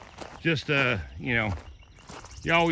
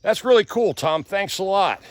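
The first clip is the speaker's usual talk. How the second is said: higher, louder imitation of impressed coworkers; mock excited coworkers; 8 semitones higher, 5 dB louder than usual. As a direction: mimic the staff gushing over the gift. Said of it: "They're all like".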